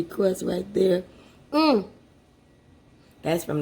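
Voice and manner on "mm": high, loud pleased hum of tasting; delighted mm. Real, not acted. Cook tasting her fresh meatloaf, a loud pleased mm. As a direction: savor the bite out loud.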